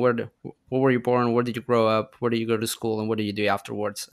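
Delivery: ordinary talk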